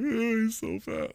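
high, slow mocking squeal. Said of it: "and just be like".

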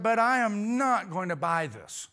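high, loud and slow, leaning on "not"; emphatic, drawn-out refusal; firm refusal. Retold or imitated. Homeowner turning down the door-to-door salesman, firm refusal.

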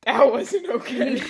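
loud laughing protest. Real, not acted. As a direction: laugh through it slowly. Laughing at flubbed take.

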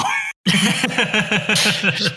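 big high hearty laugh. Pretend. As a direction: burst out laughing like a delighted dad. Dad role laughing at joke.

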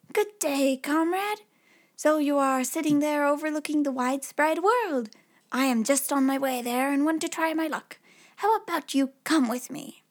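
a lightly voiced cocky tailor; bold little tailor.

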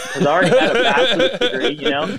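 high, quick, laughing aside. Real, not acted. Explaining he already had a degree, real laughing. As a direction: talk through your own laughter.

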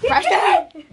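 loud high call, laughing. Real, not acted. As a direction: shout his name mid-laugh. Calling out, laughing.

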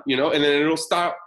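usual speaking voice

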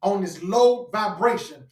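emphatic preaching. Real, not acted. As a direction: preach it as an urgent warning.

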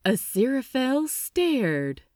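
ordinary delivery, nothing marked

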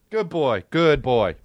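normal talking voice

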